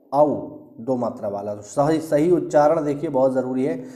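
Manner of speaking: ordinary talk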